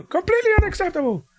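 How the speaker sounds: high, fast mock outrage; hammed-up fury; mock yell. Imitating the manager yelling at a wrestler, high mock fury. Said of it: "he yells out again".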